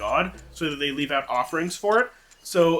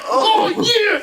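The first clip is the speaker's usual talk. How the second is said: high, loud gleeful cry; excited shout; 6 dB louder than usual. Acted. Man thrilled to see the tornado below. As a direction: cheer at the sight.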